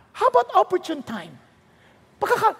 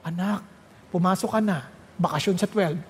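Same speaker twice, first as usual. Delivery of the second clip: parent voice; 9 semitones lower than usual. Acted. Parent waking child for school.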